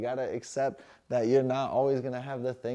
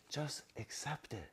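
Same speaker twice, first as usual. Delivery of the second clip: gentler; 3 semitones higher, 12 dB quieter than usual. Shown as a demonstration. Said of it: "in a better way".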